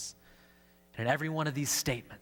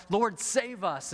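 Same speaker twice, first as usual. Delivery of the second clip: higher, slow, a touch of panic; understated cry for help; mild desperate plea; 7 semitones higher, slower than usual. Retold or imitated. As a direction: plead for rescue in the storm, lightly acted. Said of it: "they cry out".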